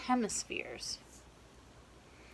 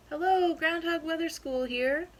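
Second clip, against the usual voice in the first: phone answerer; 7 semitones higher, 4 dB louder than usual. Acted.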